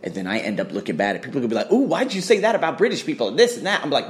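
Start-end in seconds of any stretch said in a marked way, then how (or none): 1.7-3.8 higher-pitched mimicked accusation; mock scandalized critic. People confronting him over his email, mock scandalized. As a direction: imitate a nosy critic taking offense.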